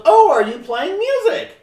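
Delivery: high, loud, slow mock excitement; eager passer-by. Another passer-by asking the musician about music, eagerly.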